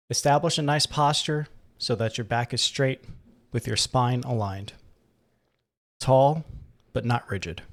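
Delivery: calm, unhurried meditation guide, gradually softening; guided meditation. Acted. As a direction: ease the listener into relaxation, leaving long pauses. Leads a breathing meditation.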